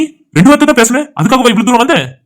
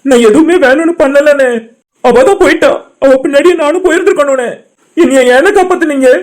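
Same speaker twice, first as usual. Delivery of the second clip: tearful anguish; 4 semitones higher than usual. Acted. Grieving man asks his friends why they saved him, crying. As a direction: plead tearfully, voice slightly raised. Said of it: "அழாத மாப்ள".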